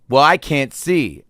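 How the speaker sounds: concert-goer whining loudly at the dancer; whiny complaint. Retold or imitated. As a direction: complain like the guy behind you. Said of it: "And then he goes".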